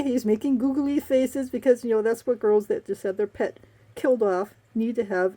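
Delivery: ordinary talk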